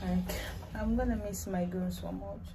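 normal talking voice

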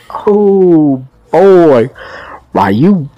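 drawn-out loud surprise; surprised shout. Real, not acted. Surprised by the fight.

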